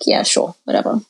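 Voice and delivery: quiet, whispered, half-hearted "whatever"; sullen whatever. Retold or imitated. Twelve-year-old answering dad's drive offer, quiet sullen mumble.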